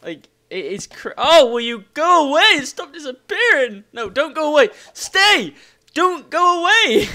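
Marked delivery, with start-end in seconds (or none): 1.2-7.1 high, loud frustrated yelling; exasperated shouting. Real, not acted. Player to vanishing leaves, exasperated shouting. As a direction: yell at it to stay put.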